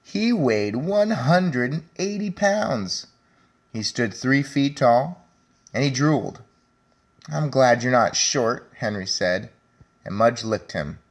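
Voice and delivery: put-on reading to children. A narrator's reading. Teacher reading Henry and Mudge to her class, lively storybook voice. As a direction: read it out brightly for the class. Teacher reads picture book aloud.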